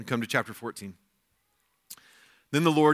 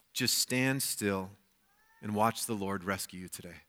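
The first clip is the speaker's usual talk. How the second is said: calm reassurance; 5 semitones lower, 6 dB quieter than usual. Retold or imitated. Moses reassuring people.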